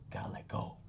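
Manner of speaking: breathy whisper; whispered, letting it sink in. Real, not acted. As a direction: hush it like a secret. Hushed key point of sermon.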